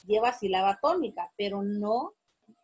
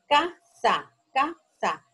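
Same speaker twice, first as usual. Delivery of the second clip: "casa" twice, each split ca... sa; syllable by syllable; 4 dB louder, much slower than usual. Shown as a demonstration. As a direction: sound out both syllables of "casa" separately, then repeat. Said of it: "no tienen fuerza de voz".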